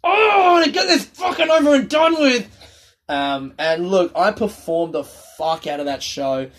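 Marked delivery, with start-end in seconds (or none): 0.0-2.5 much higher, loud frustrated outburst; exasperated inner shout. Retold or imitated. Comedian's hidden thought during the show, exasperated shout. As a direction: let the real feeling explode.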